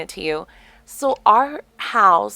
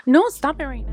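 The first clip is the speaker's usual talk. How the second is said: spooked protest; 8 semitones higher than usual. Real, not acted. Spooked by talk of checking ghosts.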